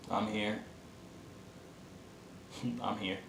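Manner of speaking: very soft, murmured to oneself; quiet, subdued. Retold or imitated. Patient sitting in a bed after the injury, quietly subdued. Quoting himself alone in hospital bed.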